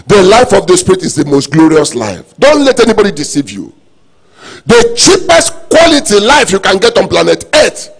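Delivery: loud, rising sermon fervor; preaching climax. Acted. Pastor urging the congregation at full force, loud. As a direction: drive it home with growing force.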